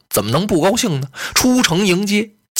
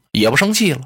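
ordinary delivery

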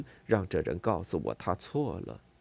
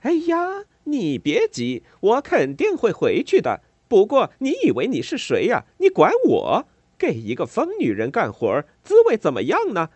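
sneering taunt; 11 semitones higher, 8 dB louder than usual. A narrator's reading. Neighbour sneering at maid.

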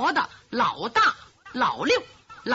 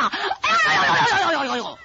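loud, higher, chirping cry of pain; comic howl of a stabbed palm; pained yelp like a cricket; 6 semitones higher, 4 dB louder than usual. Acted. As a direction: yelp over and over while shaking the hand. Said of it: "学油葫芦叫唤".

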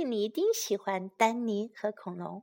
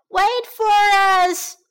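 high, slow, loud call; shouting; 10 semitones higher, 8 dB louder, much slower than usual. Acted. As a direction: call out after them. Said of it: "shouted the children".

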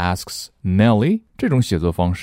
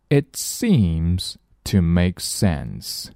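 slow, clear example sentence; slow model reading; much slower than usual. Shown as a demonstration. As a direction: read it out slowly for learners. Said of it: "我们来看例句".

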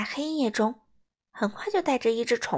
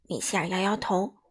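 ordinary delivery, nothing marked

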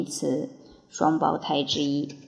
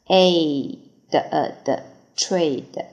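slow drill; 2 semitones lower, much slower than usual. Shown as a demonstration. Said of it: "vocabulary drill".

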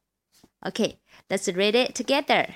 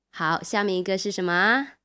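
usual speaking voice

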